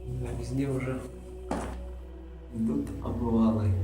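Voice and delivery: ordinary talk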